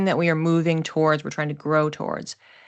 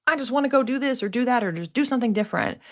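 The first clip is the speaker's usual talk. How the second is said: higher, fast and restless; mock antsy impulse, voiced a touch higher; restless urge; 7 semitones higher, much faster than usual. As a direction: rattle it off like an itchy, impatient urge.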